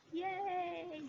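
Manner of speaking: a high, delighted squeal; excited yay. Real, not acted. Opening her quarantine box to viewers, she squeals with delight. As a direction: cheer it out high with real delight. Happy about the pins.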